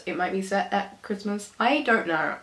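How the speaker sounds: ordinary talk, nothing marked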